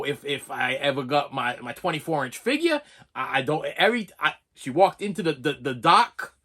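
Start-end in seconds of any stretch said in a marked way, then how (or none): none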